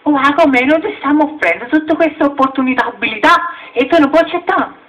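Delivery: loud, high, outraged protest; indignant shouting. Acted. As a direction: rant at him in disbelief. Heated comic argument, raised voice.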